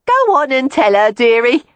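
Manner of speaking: high, loud coaxing call; teasing prostitute. A narrator's reading. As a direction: egg him on, loud and high.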